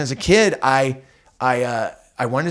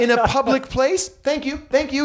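incredulous; 10 semitones higher than usual. Retold or imitated. Dad reacts to riot citation.

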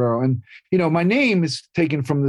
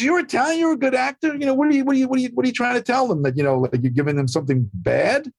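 much higher, a lightly voiced Italian mother; imitating his mom; 9 semitones higher than usual. Son voicing his mother pushing him to call Scorsese, lightly higher. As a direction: play your mom urging, pitched up.

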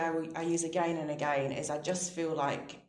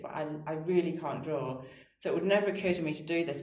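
ordinary delivery

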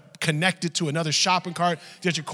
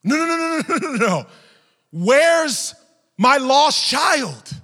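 high, loud rush of refusals, then drawn-out demand; frantic mother; 11 semitones higher, 10 dB louder, slower than usual. Retold or imitated. Mother refusing comfort about missing child, frantic shouting. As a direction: shout as an acted desperate parent.

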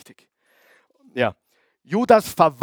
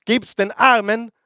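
Jesus commanding; 7 semitones higher, 3 dB louder than usual. Retold or imitated. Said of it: "Jesus ihm gerade gesagt hat".

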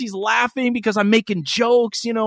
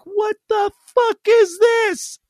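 much higher, slow, a changed imitating voice; bewildered; 13 semitones higher, slower than usual. Imitating his girlfriend puzzled by her own tears, slow disbelief. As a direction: stretch it out in disbelief. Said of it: "she was like".

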